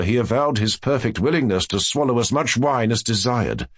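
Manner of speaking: usual speaking voice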